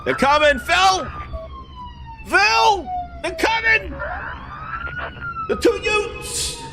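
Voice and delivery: mock alarm calling out to Phil; mock panic shout. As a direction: yell for Phil in fake alarm.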